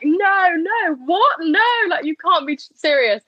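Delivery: high-pitched, mock shout of disbelief; retold shout. Patient protesting the news to the sonographer, retold shout. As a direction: act out a shocked, protesting cry, pitch jumping up. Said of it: "I just shouted at her".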